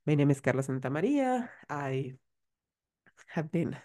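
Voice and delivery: breathless, words fading out; out of breath. Real, not acted. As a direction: say it winded and short of air. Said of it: "sorry, I'm, like, so out of breath".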